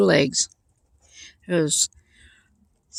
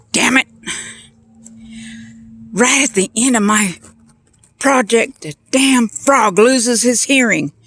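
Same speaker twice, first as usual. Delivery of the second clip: loud, high exasperated outburst; mock-angry scientist fuming over a failed experiment; pissed off; 5 semitones higher, 5 dB louder than usual. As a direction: curse it out in raised-voice frustration. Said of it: "moron scientist was getting pissed".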